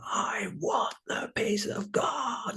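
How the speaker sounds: high, slow, urgent whisper; intense whisper. Shown as a demonstration. Showing a wholehearted prayer, slow high urgent whisper. Contrast to lukewarm wanting.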